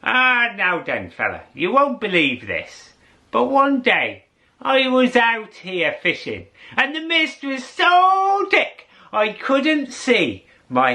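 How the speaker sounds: put-on high, lilting old fella telling a tall tale; old fisherman voice. Old fisherman boasting to the narrator about mist. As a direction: spin the yarn like an old Irish fisherman. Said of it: "And he said to me".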